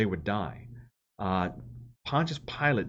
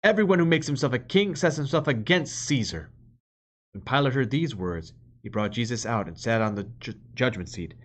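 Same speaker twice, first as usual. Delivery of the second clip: louder, measured reading of the Gospel; scripture reading; 2 semitones higher, 4 dB louder than usual. Acted. Preacher reads the Passion to the congregation, solemn proclamation.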